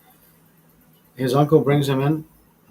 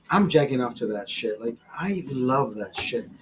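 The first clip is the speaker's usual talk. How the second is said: ordinary talk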